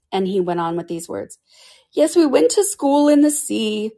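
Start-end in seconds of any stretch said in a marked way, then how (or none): 1.9-3.9 high, slow, mournful turtle voice; a sad old sea creature remembering school; Mock Turtle telling Alice of his schooldays, high and slow; sobbing Mock Turtle.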